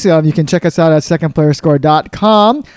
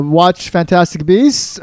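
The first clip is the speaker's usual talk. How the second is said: usual speaking voice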